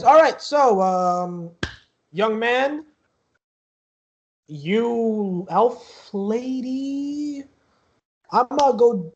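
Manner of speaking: high, loud guard picking people out; security guard addressing group. Acted. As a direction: address each one loudly, stretching words out. Guard addresses the players in turn.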